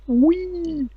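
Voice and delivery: excited high-pitched whee; high squeal. Acted. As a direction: squeal it like a kid.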